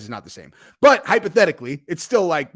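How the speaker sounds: ordinary delivery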